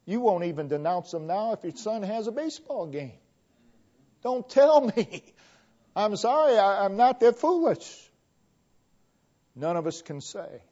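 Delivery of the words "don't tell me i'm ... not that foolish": sarcastic retort. Acted.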